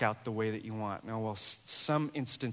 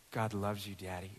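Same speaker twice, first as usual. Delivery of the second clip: under her breath; 5 semitones lower, 7 dB quieter than usual. Retold or imitated. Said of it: "under her breath".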